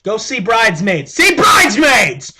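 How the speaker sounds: building from an urging call to a loud slow shout; excited shout. Real, not acted. Vlogger urging viewers to see a film, shouting. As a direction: yell it at the viewers, stretched out.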